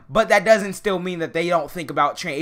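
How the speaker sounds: ordinary talk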